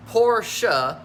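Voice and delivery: "Porsche" said as POR-shuh, two syllables, stressed first. Shown as a demonstration. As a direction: say "Porsche" as por-sha with a clear final vowel. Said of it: "It's actually two words".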